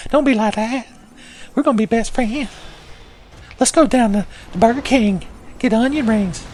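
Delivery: high-pitched ghost sidekick voice; Gower. Acted. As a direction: pitch it way up as an overfriendly neck-hugging ghost. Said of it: "He's like".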